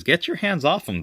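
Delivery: high-pitched acted scolding; jealous outrage. Girlfriend kicking the monkey off her man, high outrage. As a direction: warn them off in a high voice.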